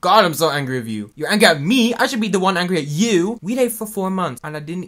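loud, heated back-and-forth; angry quarrel. Acted. Exes arguing over who should be angry, loud and heated. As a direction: raise voice and pitch as the row builds.

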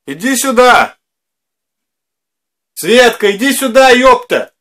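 loud call across the house. Real, not acted. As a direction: shout for her from the next room. Calling wife from another room.

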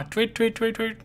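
high-pitched chirped tweets; birdsong tweeting. Acted. Narrator voicing birds at morning, high birdsong tweeting. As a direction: chirp like a little morning bird.

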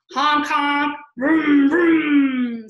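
loud, punchy honks and revs; booming pretend car sounds; vehicle noises. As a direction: belt it out like city traffic. Voices noisy book title.

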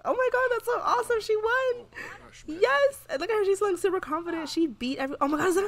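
high-pitched, thrilled squeal of celebration; excited cheer. Real, not acted. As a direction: squeal with delight at the win. Her Sim wins debate.

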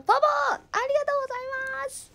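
high, loud, very fast squeal of thanks. Real, not acted. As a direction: gush thanks to the donor. Thanking a donor on stream. (高い声ではしゃいでお礼)

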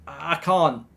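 ordinary talk

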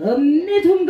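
high, stretched Jeolla exclamation; Jeolla dialect. Shown as a demonstration. Performer demonstrating the Jeolla version for the crowd, Jeolla dialect. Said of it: "전라도 버전".

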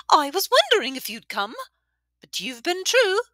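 high, loud, cackling tavern woman; boisterous old widow. A narrator's reading. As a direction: hail an old friend across the room. Widow voice continues.

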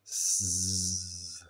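imitated zzz of someone asleep; drawn-out sleeping buzz. A narrator's reading. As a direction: buzz one long, even zzz. Sleeping Lulu's snore imitated.

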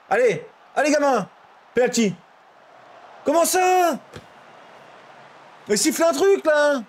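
loud, high shouts at the screen; yelling from the sidelines. Real, not acted. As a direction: yell at the referee. Penalty, shouting at game.

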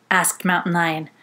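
ordinary delivery, nothing marked

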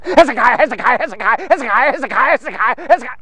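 rapid-fire tongue twister; real fast repetition. Shown as a demonstration. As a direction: rattle off the name nonstop, words running together. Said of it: "say Hezekiah ten times real fast".

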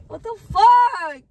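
high, wailing sobs of frustration; stressed crying meltdown. Real, not acted. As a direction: cry out your complaint, swelling into shouts. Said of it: "now I'm stressed".

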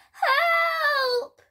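very high, drawn-out cartoon cry for help. A narrator's reading. Fish caught in a trap call for help, crying out. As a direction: squeal it like a tiny trapped fish. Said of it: "cried the fish".

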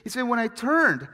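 loud, high, excited preacher voice; impassioned preaching. Real, not acted. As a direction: build to full pulpit fervour. Preaches at full force.

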